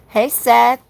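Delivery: loud, high shout across the yard; calling out. Real, not acted. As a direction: yell his name to be heard far off. Calling out for missing kids.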